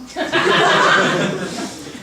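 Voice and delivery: loud unvoiced burst of laughter; breathy laugh. Real, not acted. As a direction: laugh out at your own joke. Laughs at own joke.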